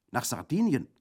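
high, loud indignant question. A narrator's reading. Bandit answering Orso's suggestion, indignant. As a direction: exclaim it in affront. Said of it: "rief Brandolaccio entrüstet".

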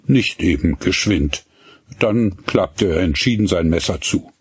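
ordinary delivery

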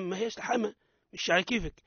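ordinary delivery

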